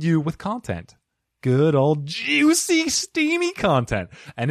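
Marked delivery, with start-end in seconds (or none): 1.4-4.1 slow and much higher, drawn-out tease; over-the-top high sultry croon; campy sultry. Pretend. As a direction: make juicy content sound decadent. Sultry steamy content tease.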